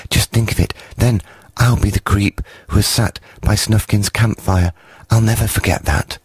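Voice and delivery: beaming little creature; happy creep. A narrator's reading. Creep dreaming of Snufkin's campfire, high and happy. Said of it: "face shining with happiness".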